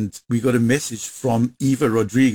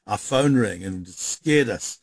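ordinary talk

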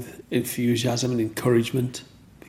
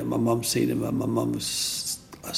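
ordinary talk

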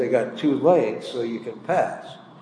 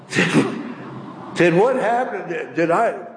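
stuttering in disbelief; stammering; 4 semitones higher than usual. Retold or imitated. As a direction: trip over the words in shock. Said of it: "He stammered".

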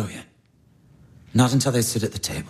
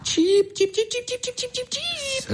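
very high, fading chick cheeps; chick peeping; 4 dB quieter than usual. Acted. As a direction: peep like a baby bird, higher each time. Mocking chick peeps.

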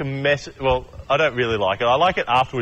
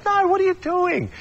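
much higher, cranky retort; cranky Jesus. Acted. Jesus gets cranky with the disciples, high put-on retort. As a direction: snap back in a high cranky voice. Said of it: "he gets cranky with them".

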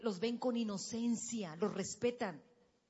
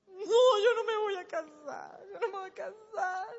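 put-on wailing like a small girl in tears; imitating crying daughter; 8 semitones higher, 6 dB louder than usual. Little daughter wailing she won't marry, high and loud. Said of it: "Y se atacaba a llorar".

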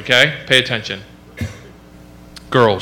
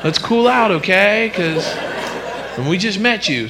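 tentative, higher-pitched coaxing; mock placating dad; 6 semitones higher, slower than usual. Clueless new dad soothing his newborn, mock placating. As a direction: lightly play an awkward dad negotiating.

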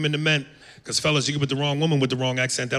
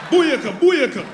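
higher, louder dancehall shout; Jamaican cheer; 11 semitones higher, 6 dB louder, slower than usual. Retold or imitated. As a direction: whoop it like a dancehall crowd.

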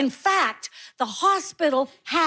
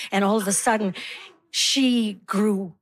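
ordinary talk, nothing marked

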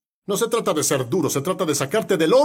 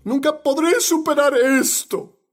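acted despair; 9 semitones higher than usual. Believer overwhelmed by problems, acted despair. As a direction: wail it as someone overwhelmed by troubles.

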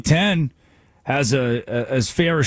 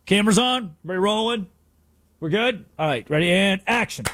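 mock Brady; 6 semitones higher than usual. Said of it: "mock Brady directing cameras".

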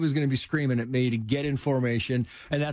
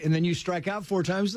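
ordinary talk

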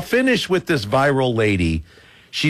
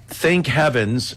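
normal talking voice

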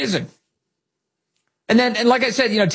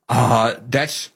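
ordinary talk, nothing marked

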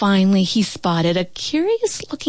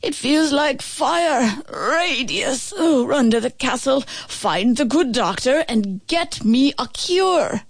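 pained knight; 5 semitones higher than usual. A narrator's reading. Stricken father sends his son for a cure. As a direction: beg weakly, doubled over.

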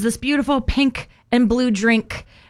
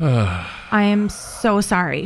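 imitating sorry barista; 5 semitones lower, much slower than usual. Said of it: "She's like".

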